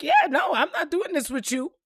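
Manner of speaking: imitated friend, pitched high; friend refusing. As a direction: put on a friend's firm, high-voiced refusal. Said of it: "she was like".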